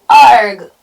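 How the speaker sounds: loud falling howl; storybook villain's defeated cry; troll howl. A narrator's reading. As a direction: howl as you are knocked away. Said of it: "howled the troll".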